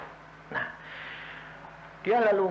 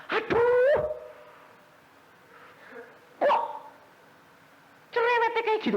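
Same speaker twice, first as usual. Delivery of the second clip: much higher mock voice of someone fed up; annoyed grumble. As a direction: mimic a person irked by nagging. Mocking an irritated reaction. (menirukan orang kesal, suara tinggi)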